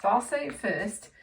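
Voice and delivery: usual speaking voice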